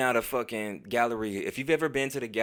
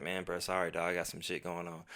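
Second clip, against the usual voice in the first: lower, soft mumbled apology; mumbled brush-off; 5 semitones lower, 8 dB quieter than usual. Retold or imitated. Ducking the friend's party invite, low mumble. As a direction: mumble it like you don't mean it.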